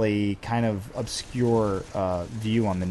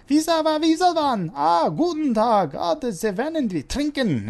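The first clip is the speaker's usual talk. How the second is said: very high, loud mock-German rant; mini Hitler; 5 dB louder than usual. Joking about his own German accent, tiny ranting German dictator. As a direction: shriek it in a hammed-up German accent. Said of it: "sounds like mini Hitler when I do it".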